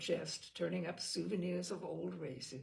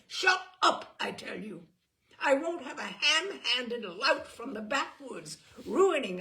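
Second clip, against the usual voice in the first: high loud angry yell; furious shout; 6 semitones higher, 10 dB louder than usual. A narrator's reading. Simon yelling at Eleanor, furious. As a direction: shout her down.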